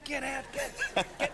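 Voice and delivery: mock urgent yell, pitched high; high-pitched retold shout, half laughing; imitated police calling out. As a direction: play frantic coppers ordering a driver away. Imitates police waving him away.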